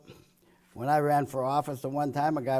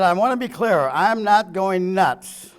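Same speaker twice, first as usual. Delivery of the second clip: loud, slow mock insistence; mock loud quote; 4 semitones higher, 10 dB louder, slower than usual. Speaker mocking Biden for the crowd, loud slow mock insistence. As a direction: hammer it out like a rattled politician insisting.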